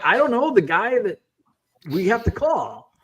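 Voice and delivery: high-pitched, exasperated comeback; exasperated retelling. Retold or imitated. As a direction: replay a flustered domestic back-and-forth. Acts out flustered exchange with wife.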